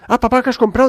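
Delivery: put-on high, loud child's call; excited children. Children greeting dad over the beans, excited and loud. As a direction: voice delighted kids running up to dad. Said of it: "Entonces salieron los niños".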